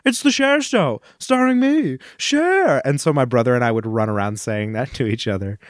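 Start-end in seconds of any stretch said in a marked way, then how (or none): 0.0-2.8 high, grand announcer-style [name] impression. Quoting the [name] Broadway ad, high and grand. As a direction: belt it out like the Broadway ad. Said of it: "an ad that would always play".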